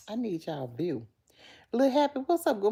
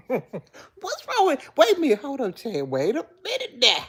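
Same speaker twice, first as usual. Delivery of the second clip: loud, higher-pitched objection; mock protest; 8 semitones higher, 6 dB louder than usual. Real, not acted. Halting chat over the albino remark, mock protest. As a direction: stop them short, loud and incredulous.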